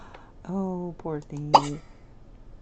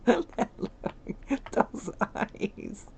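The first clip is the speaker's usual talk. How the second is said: breathless laughter; 7 semitones higher, much slower than usual. Real, not acted. Cracking up at Sim's face.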